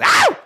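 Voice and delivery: short, high, loud mock yelp; imitated sudden scream. Imitating a boy who screams at takeoff, sudden high yelp. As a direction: shriek suddenly, like a startling kid. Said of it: "pick the most randoms of time to scream".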